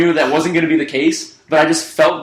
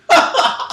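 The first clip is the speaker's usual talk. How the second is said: high breathy laugh. Real, not acted. Laughs after quote.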